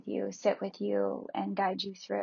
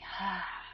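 long audible sigh; 7 dB quieter than usual. Shown as a demonstration. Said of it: "Breathe out now some of that stuck energy".

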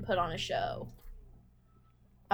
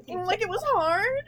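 mock smug quote; 10 semitones higher than usual. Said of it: "looked at me and was like".

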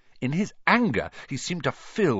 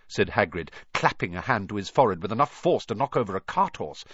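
usual speaking voice

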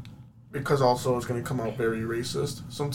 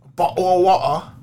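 loud, high caricature British accent; 7 semitones higher, 10 dB louder than usual. Shown as a demonstration. Showing off his British, loud exaggerated British accent. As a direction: belt it in a broad British accent. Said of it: "I can easily do British".

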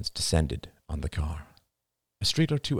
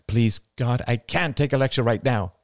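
exasperated groan of a plea, a bit louder; 7 semitones higher, 5 dB louder than usual. A narrator's reading. Wife begs husband to spare her a lecture, exasperated. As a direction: beg him to stop lecturing.

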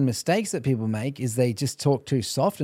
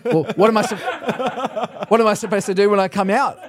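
louder, higher mock-sassy back-talk; imitated sass; 5 semitones higher, 4 dB louder than usual. Demon answering the exorcist back, imitated sass. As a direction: throw it back indignantly, with a laugh near the end. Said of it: "A bit of sass".

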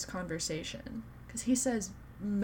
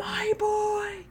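very high, louder cry of adoration; adoring fan squeal; 5 dB louder than usual. Real, not acted. Fan hearing Cedric Diggory named, high adoring squeal. Fan exclaims over favourite character.

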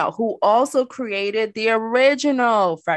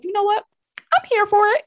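much higher, playful, delighted quote; excited inner voice; 12 semitones higher than usual. Retold or imitated. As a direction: squeal it like you are suddenly sold. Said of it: "I was like".

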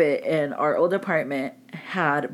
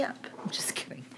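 whispering; 14 dB quieter than usual. Real, not acted. Joking aside to sponsors.

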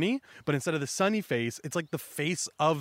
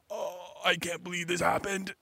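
softer, strained mock dismay; acted upset; 3 semitones higher, 5 dB quieter than usual. Buggy upset over the ship, soft acted dismay. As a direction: lament it under your breath, upset. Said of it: "him upset".

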